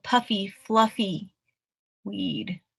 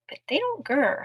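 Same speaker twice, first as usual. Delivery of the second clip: plain reading voice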